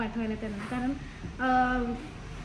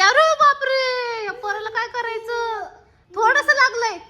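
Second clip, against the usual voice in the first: dismay; 8 semitones higher, 7 dB louder than usual. Real, not acted. Child got hurt.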